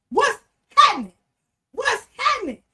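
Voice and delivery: loud, high hype welcome to a fellow channel; excited hype greeting. Real, not acted. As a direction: greet them like a crowd just walked in. Host hypes a channel shout-out.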